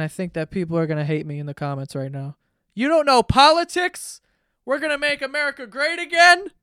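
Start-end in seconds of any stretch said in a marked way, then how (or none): 2.7-6.5 high, loud imitation of a heckler; mock angry commenter. Host imitating haters in the comments, mock outrage. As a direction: jeer it high and loud, exaggerated.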